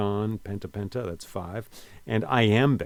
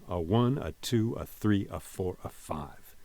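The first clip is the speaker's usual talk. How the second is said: "a one, a two, a three, a four, a five" with each number stressed; light "a", weighted number, five times over, falling away by "five"; counted iambic beat; 4 dB quieter than usual. Shown as a demonstration. Teacher counting out iambic pentameter, stressing each number in rhythm. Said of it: "That's all you gotta remember".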